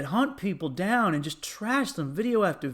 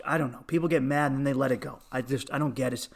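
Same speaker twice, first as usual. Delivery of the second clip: normal talking voice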